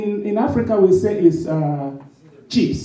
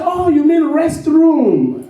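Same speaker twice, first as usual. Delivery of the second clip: high, slow, drawn-out imitation of the host; amused mimic of an American asking "you mean restroom?"; mock American host; 10 semitones higher, 3 dB louder, much slower than usual. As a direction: ham up the puzzled host, much higher and slowed right down. Host's puzzled reply, retold.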